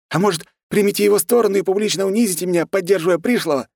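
fast, high-pitched sarcastic challenge; indignant outburst. A narrator's reading. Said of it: "не выдержал он". (возмущённая тирада, быстро и высоко)

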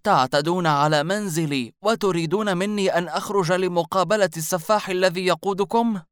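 put-on loud, high angry old woman; indignant outburst, pitched high; غضب. A narrator's reading. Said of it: "أجابته المرأة في غضب". (بغضب عال وصوت مرتفع)

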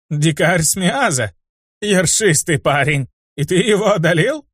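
slow, doubtful, rising to a shout; can't believe it, then shout it out; disbelieving satyr. Acted. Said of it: "С недоверием в голосе спросил Козлоногий".

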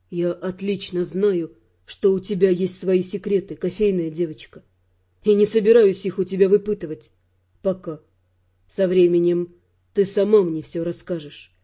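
low, measured male character voice; Raymond, self-assured man. Acted. Raymond telling the heroine she will confide in him, low and assured. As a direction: speak low and knowingly, unhurried.

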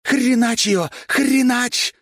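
very high, loud screaming cheer; frenzied yelling. A narrator's reading. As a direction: yell yourself wild for your fighter. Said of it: "не своим голосом орала".

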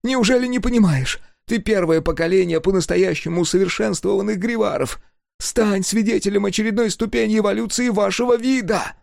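high, gleeful fanatic's voice; manic engineer. A narrator's reading. Engineer grinning manically at Sega, high and gleeful. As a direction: preach the new species with mad delight. Расплылся в маниакальной улыбке.

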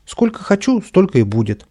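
high, loud bratty insistence; stubborn. A narrator's reading. Boy arguing sums with the painter, stubborn. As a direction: dig in like a wilful child. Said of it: "упрямился Каприска".

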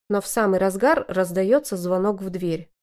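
ordinary delivery, nothing marked

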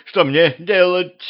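tearful wail climbing high at the end; narrated sobbing lament; bawling. A narrator's reading. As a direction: wail it helplessly, slowing down. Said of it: "ещё пуще заревела Мария".

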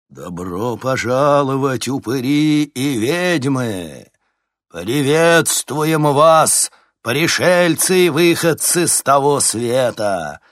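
drawn-out haunted MC over speakers; sepulchral DJ voice. A narrator's reading. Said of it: "замогильный голос диджея Максима, многократно усиленный динамиками". (замогильный голос диджея)

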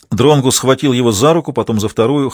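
ordinary delivery, nothing marked

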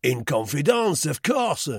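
high-pitched, mocking taunt; jeering. A narrator's reading. Roux mocks Duclos's admission, jeering. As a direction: jeer his excuse back at him. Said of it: "he jeered".

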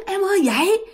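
high pleading call to wake up; family calling. Retold or imitated. Husband and kids calling Thi to wake, high pleading call. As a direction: call her to wake from afar. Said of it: "còn nghe tiếng".

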